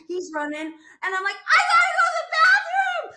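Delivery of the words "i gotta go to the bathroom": high laughing yell; desperate laughing shout. Retold or imitated. Runner telling husband she needs the bathroom, laughing yell. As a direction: shout it through laughter, bursting.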